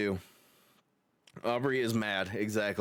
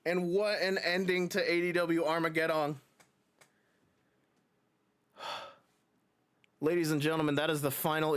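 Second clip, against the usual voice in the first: wrestling commentator; 7 semitones higher than usual. Acted. Wraps up match commentary.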